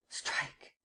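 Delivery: hissed whispered order; whispered command. Acted. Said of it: "and commanded".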